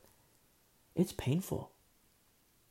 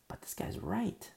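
grudging whisper; 8 semitones higher, 6 dB quieter, slower than usual. Retold or imitated. Admitting the rebuke was right.

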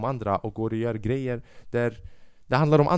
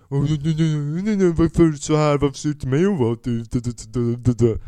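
lightly voiced ranting audience member; mocking angry woman; 2 semitones higher than usual. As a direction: mimic her ranting at the MC. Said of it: "började skälla ut henne".

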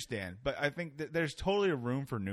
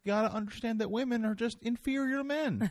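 high, put-on lecturing voice, mocking; mocking Jordan Peterson; 10 semitones higher than usual. Host mocking a self-help professor to his guest, high put-on lecturing voice. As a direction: lampoon a preachy professor, pitched high. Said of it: "like, some Canadian guy".

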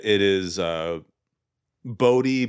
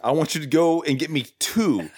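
higher-pitched order landing on a long "two"; stress on two; 5 semitones higher than usual. Retold or imitated. Cop sending his partner for sandwiches, stress on two. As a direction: draw out "two" with a rise and fall in pitch.

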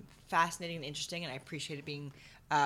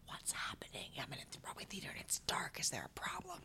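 anxious-playgoer whisper, very soft and voiceless; hushed whisper; 13 dB quieter than usual. Acted. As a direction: whisper it like you're sitting in a darkened theater. Playgoer suddenly left in the dark.

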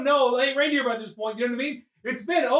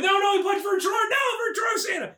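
much higher, shouted brawl commentary; excited fight call; play-by-play yelling; 9 semitones higher than usual. Pretend. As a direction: call the fight like a ringside announcer. Calling the brawl.

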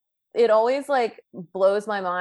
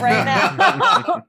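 slow, amused teasing; laughing; 8 semitones higher, 5 dB louder than usual. Retold or imitated. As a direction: tease through a laugh. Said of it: "I'm like".

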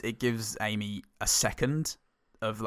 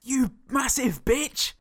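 hammy mock insult; 4 dB louder, much slower than usual. Mock movie line.

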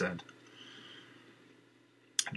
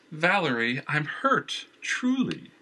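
mock hurt; 7 semitones higher, slower than usual. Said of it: "as if he had been stabbed".

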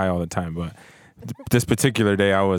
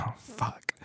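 breathy hushed curse, no voice at all; whispered dread; 12 semitones higher, 14 dB quieter than usual. Retold or imitated. Stoned student whispering when the teacher calls on him. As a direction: whisper it like you've just been caught. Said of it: "I was like".

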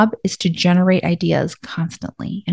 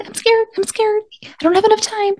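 very high, small frightened puppy voice; scared whimpering puppy; 13 semitones higher, 4 dB quieter than usual. Retold or imitated. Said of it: "they kind of whimper all the time".

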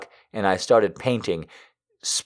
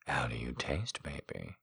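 low, soft and teasing; 8 semitones lower, 18 dB quieter than usual. A narrator's reading. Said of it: "he asked as he slowly".

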